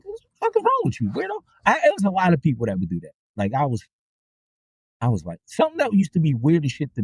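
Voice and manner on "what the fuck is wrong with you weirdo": high, exasperated disbelief; mock-shocked high outburst; incredulous. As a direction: squeal at the weirdo, baffled. Driver's retold reaction.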